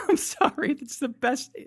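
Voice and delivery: high, giggly delight; laughing apology. Real, not acted. Laughing after his accent bit.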